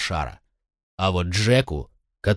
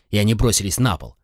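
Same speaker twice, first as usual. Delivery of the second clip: usual speaking voice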